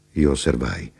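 plain reading voice

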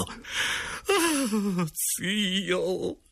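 high, slow, wailing sobs; hammed-up tearful plea, begging forgiveness; floods of tears, stammering. A narrator's reading. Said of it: "dette in un pianto dirotto, balbettando". (pianto dirotto e balbettante)

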